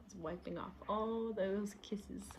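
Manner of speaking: soft whispered aside. Real, not acted. Reader pointing at the picture, whispered. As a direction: lean in and whisper it to the listener.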